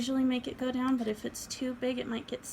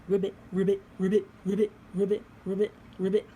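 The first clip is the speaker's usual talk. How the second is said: frog croak; 6 dB louder, faster than usual. Pretend. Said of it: "How about frogs?".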